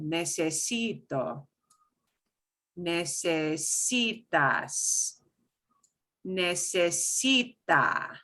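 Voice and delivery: each form slowed, stressing "si"; slow stress demo. Shown as a demonstration. Teacher showing student stress on "si" in "Necesito", slowly. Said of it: "So second to last syllable".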